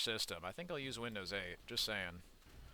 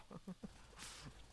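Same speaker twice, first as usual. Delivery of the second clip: unvoiced high wheezing laugh; a quick silent-ish giggle; soft airy laugh at his own joke; breathy high giggle; 10 semitones higher, 14 dB quieter than usual. Real, not acted. Laughing at the arrest record.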